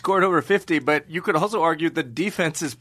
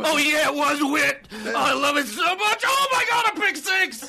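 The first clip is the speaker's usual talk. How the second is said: squealing high thrilled sportscaster; giddy commentator; 9 semitones higher than usual. Retold or imitated. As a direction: shriek with delight over the play. Mocking excited ESPN commentator.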